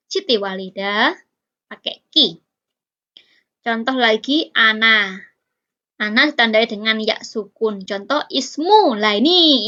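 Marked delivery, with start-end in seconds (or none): none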